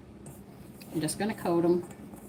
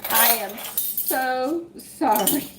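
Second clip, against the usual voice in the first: very slow, stretched sorry, pitched higher; drawn-out apology; 7 semitones higher, 3 dB louder, much slower than usual. Real, not acted. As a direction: draw the words out like a sheepish apology to viewers. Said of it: "I'm talking to you".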